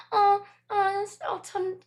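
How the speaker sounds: high, loud startled cry. Real, not acted. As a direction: jump at the sudden noise. Cat screeches suddenly.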